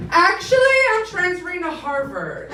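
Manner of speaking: high, loud mock-proud declaration; lofty announcement. Student announcing a transfer to the dean's office, high and grand.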